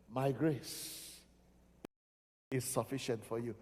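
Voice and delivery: very low, soft and measured; God's reassuring answer. Acted. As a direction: reassure quietly, with long pauses. God answering Paul in sermon.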